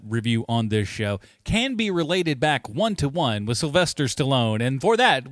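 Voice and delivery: ordinary talk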